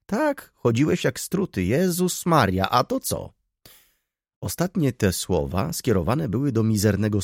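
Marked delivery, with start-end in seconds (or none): 0.1-3.3 wysoki głos staruszki, przejęty; staruszka. Acted. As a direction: zagraj gadatliwą staruszkę wysokim głosem. Old lady voiced by narrator. (wysoki, przejęty głos staruszki)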